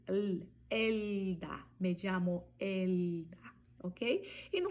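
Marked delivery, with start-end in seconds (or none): 0.7-3.4 ELDA with a clear tongue-tip L, never a U; slow model of the L in Elda; Spanish L. Shown as a demonstration. Teacher showing learners the L before a consonant, Spanish L. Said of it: "esse mesmo som".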